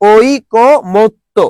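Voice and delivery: ঐকমত্য drawn out, oi... ko... mot... to; correct pronunciation by syllables. Shown as a demonstration. Teacher repeating for the class, ঐকমত্য as slow oi-ko-mot-to. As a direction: spell out ঐকমত্য by sound with a single k, very slowly. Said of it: "কি হবে বলো তো".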